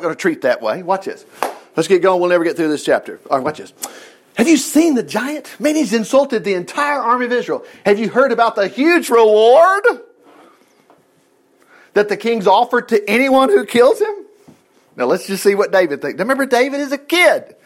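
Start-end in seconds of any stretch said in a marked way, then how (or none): 4.3-14.1 higher, urgent storytelling voice; excited soldier gossip. Acted. Acting out soldiers' excited talk.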